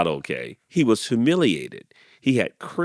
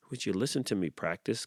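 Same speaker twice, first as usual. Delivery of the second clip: quick, soft ask; shy request; 9 dB quieter, faster than usual. A narrator's reading. Rooster timidly asking the goose, soft quick. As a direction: mumble it shyly and fast. Clyde asks shyly.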